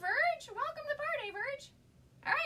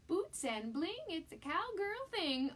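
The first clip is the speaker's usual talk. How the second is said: sign read; 6 semitones higher, 5 dB louder, slower than usual. Acted. Reading the cute sign aloud.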